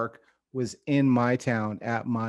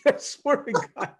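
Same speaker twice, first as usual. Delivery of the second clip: higher, laughing through the words; laughing embarrassed; 11 semitones higher than usual. Real, not acted. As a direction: squeal it out with cringe. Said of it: "This is the most embarrassing thing".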